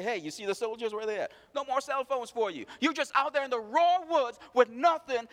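normal talking voice

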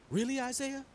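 high, soft incredulous question; hushed, high-pitched surprise; acted disbelief. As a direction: ask it softly in stunned disbelief. Hezekiah reacts to Isaiah's message.

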